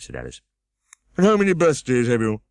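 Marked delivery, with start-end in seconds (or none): 1.2-2.4 higher, louder pompous scold; Humpty Dumpty scolding. A narrator's reading. Humpty Dumpty scolding Alice, higher and louder. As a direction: snap at the girl from the wall.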